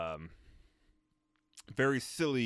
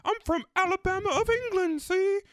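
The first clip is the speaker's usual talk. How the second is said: put-on very high, loud caricature of an English accent; mock Melanie accent; 5 dB louder than usual. Friend mocking both Melanies' accent, shrieky English caricature. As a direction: screech out a hammed-up English regional accent. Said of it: "both Melanie's accent".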